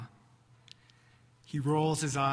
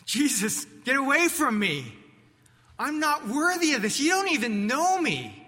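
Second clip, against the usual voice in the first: anguished outcry; 10 semitones higher, 7 dB louder than usual. Acted. Peter overwhelmed before Jesus.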